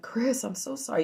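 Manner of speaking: mild tender husband; soft sorry. Retold or imitated. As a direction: say it gently for him. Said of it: "He's like".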